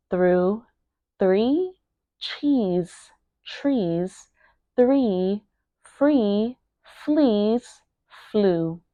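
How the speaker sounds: each word alone with a pause after. Shown as a demonstration. Teacher modelling a tongue twister for learners, word by word. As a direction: say it word by word, sounding each one carefully. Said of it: "make sure that you are pronouncing each word".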